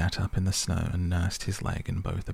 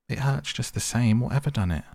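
higher, louder pained complaint; 6 semitones higher, 3 dB louder than usual. Acted. Mole complains to the rat about his shin, pained and higher. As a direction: whine about the hurt. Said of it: "in his pain".

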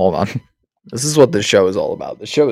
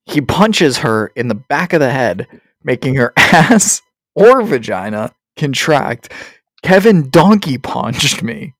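slow, higher-pitched, drawn-out recital; mock-official read-aloud; 6 semitones higher, 4 dB louder, slower than usual. Host reads crude definition and its example sentence, mock-official. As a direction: read it out deliberately, savouring each word.

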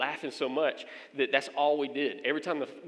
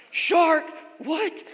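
high, loud mock outburst; hammed-up shriek of surprise; shocked disbelief; 8 dB louder than usual. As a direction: exclaim it as an astonished listener. Listeners react in shock to shark.

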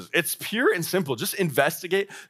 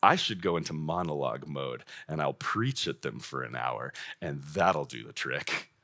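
mock smug plan; 12 semitones lower, 7 dB quieter than usual. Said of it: "we're like".

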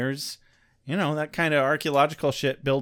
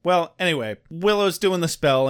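ordinary delivery

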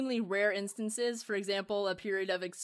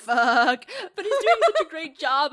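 loud, drawn-out mock sob on a curse; mock crying; 6 semitones higher, 10 dB louder, slower than usual. Imitating a character despairing at Daniel's corrections, mock crying. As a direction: whimper it out in exaggerated despair. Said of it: "Cries softly".

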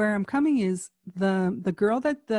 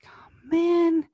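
exasperated mock whisper; 8 semitones higher, 6 dB louder than usual. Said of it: "I was like".

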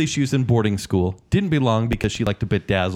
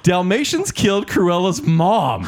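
much higher, excited outburst; outraged disbelief; 9 semitones higher than usual. Real, not acted. Hosts react in disbelief to the dog twist, high and excited. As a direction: blurt out the shock of the twist.

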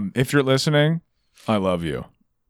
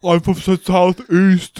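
louder, higher mock London accent; mocking British accent; 4 semitones higher, 4 dB louder, slower than usual. Host mimicking guest's attempt, mocking British accent. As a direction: exaggerate his bad British accent. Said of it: "Is that not a good accent?".

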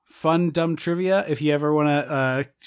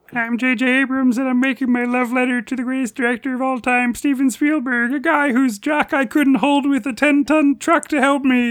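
much higher nerdy Kermit-like voice; J J Abrams; 9 semitones higher than usual. Acted. Podcaster mocks the director gushing over Spielberg, high nerdy impression. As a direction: gush in a high Kermit-style nerd voice. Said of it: "Why are you doing George Lucas for J J Abrams".

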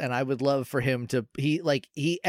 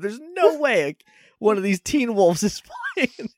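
laughing disbelief; 8 semitones higher than usual. Retold or imitated.

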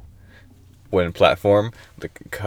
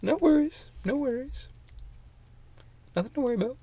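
mock innocent; 11 dB quieter, faster than usual.

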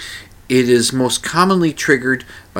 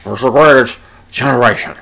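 louder mock anchorman gravitas; hammed-up newsman pronouncement; Tom Brokaw; 6 dB louder, faster than usual. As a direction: play the solemn news anchor. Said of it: "that Tom Brokaw says".

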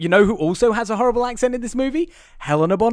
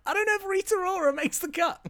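high, softer sceptical voice; squeaky doubt; 12 semitones higher, 5 dB quieter than usual. Real, not acted. Host doubting a guest's invitation, high-pitched scepticism. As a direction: pitch leaps up in reluctant doubt.

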